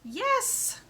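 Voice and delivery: excited yes. Real, not acted. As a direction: cheer the right answer. Praising child's right answer.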